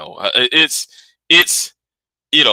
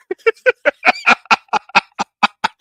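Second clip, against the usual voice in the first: a burst of high laughter. Real, not acted. Laughing high at his own rant about videos.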